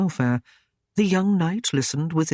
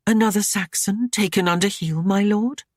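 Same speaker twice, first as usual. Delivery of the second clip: higher, arch and needling; teasing lady voice; 6 semitones higher than usual. A narrator's reading. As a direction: tease him sweetly. Ashlyn needling her lord.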